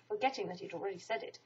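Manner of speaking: ordinary delivery, nothing marked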